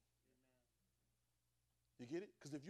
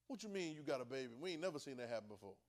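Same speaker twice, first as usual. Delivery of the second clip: ordinary talk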